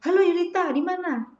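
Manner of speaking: high, bright "Halo Yulita"; lecturer's lively call. Shown as a demonstration. Lecturer phoning a student, lively and high. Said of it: "kalau misalnya dosennya nanya".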